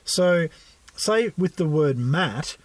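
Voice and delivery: ordinary talk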